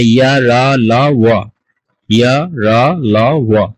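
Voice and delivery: ইয়া রা লা ওয়া slowly, each syllable held; letter-sound demonstration. Shown as a demonstration. Teacher models letters for students, ইয়া রা লা ওয়া slow and separate. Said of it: "ওয়া উচ্চারণ করতে হবে".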